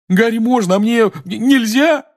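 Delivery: put-on boy wailing at his mother; howling complaint. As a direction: howl the unfairness. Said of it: "взвыл Рон".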